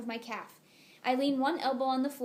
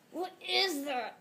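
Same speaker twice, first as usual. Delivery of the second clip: strained, slow, forced through clenched teeth; through gritted teeth; 6 semitones higher, slower than usual. Acted. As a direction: squeeze the words out past gritted teeth. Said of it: "I force out through gritted teeth".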